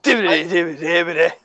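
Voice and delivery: zombie noise. Acted. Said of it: "hear the multiple tones in that".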